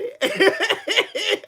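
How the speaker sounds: high, quick cackle; mocking laugh. Real, not acted. Streamer laughing at Tito Cheeto man, high mocking cackle. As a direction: giggle high at the loser.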